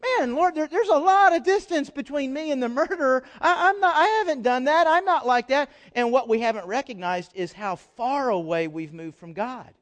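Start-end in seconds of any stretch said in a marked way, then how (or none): 0.0-5.7 self-righteous churchgoer. Retold or imitated. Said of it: "looking at the murderer and saying".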